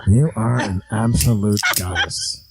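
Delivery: Crazy Uncle Charlie voice. Acted. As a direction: growl it like a creepy uncle.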